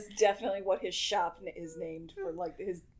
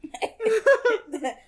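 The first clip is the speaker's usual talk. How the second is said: loud laugh; 13 semitones higher, 7 dB louder than usual. Real, not acted. Bursting out laughing.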